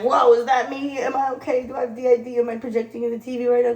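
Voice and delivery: playfully freaked out; mock alarm. Retells alarmed reaction to show.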